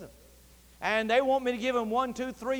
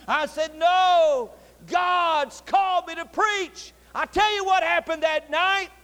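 very high, loud, drawn-out declarations; full-force preaching; 8 semitones higher, 6 dB louder, much slower than usual. Acted. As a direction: proclaim it at the top of the preaching voice.

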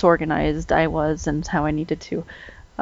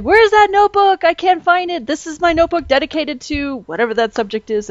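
frantic searching; 9 semitones higher than usual. Retold or imitated. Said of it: "I'm like".

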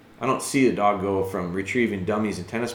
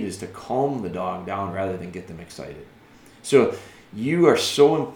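ordinary talk, nothing marked

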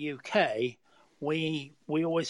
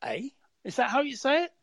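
surprised; 8 semitones higher than usual. Retold or imitated. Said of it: "and I thought".

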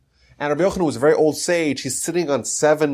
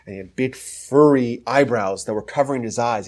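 usual speaking voice

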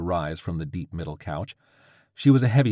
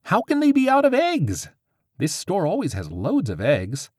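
high, loud shocked outburst; 11 semitones higher, 5 dB louder than usual. Acted. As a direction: blurt it in disbelief. Witch shocked, eggs sold out.

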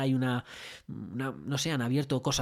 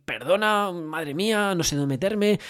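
higher, louder, flustered sorry; flustered apology; 5 semitones higher, 5 dB louder, slower than usual. Retold or imitated. Waiter apologising to the allergic customer, flustered. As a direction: blurt out a panicked apology. Apologising after the onion mistake.

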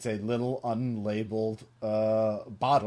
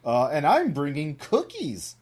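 higher, lilting cartoon lady voice; sing-song storybook animal, pitched up; Missus Fox; 5 semitones higher, 3 dB louder than usual. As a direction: play a chirpy little cartoon character. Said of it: "and Missus Fox says".

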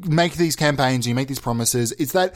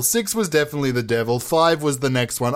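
ordinary talk

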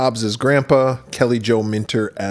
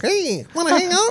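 put-on much higher little-kid voice. Imagining his younger self asking a teen, a high kid voice. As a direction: pipe up like an eager kid. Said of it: "You would have been like".

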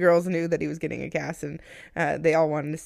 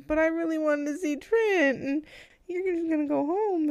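high, pleading whine; whiny high voice; 10 semitones higher than usual. Retold or imitated. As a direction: whine it like a let-down kid. Wife retells her disappointed reply.

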